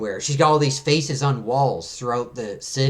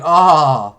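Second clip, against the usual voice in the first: loud, drawn-out "oh"; mock yell of being landed on; hit cry; 7 dB louder than usual. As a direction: imitate crying out on impact. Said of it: "he's like".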